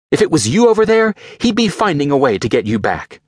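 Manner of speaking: high, fervent belief in Tony; Pepper, insistent. Acted. Pepper insists to Rhodey that Tony survives, high and fervent. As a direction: insist Tony would beat the odds.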